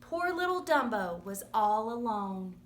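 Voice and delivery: ordinary delivery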